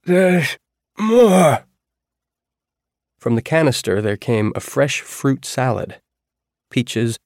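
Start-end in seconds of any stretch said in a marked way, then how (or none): 0.0-1.7 put-on high, slow, rasping old man's voice; rough dying voice. A narrator's reading. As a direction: croak it out like a dying man. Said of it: "Percy said in his rough, dying voice".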